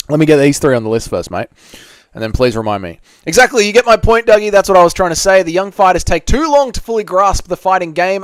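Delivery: usual speaking voice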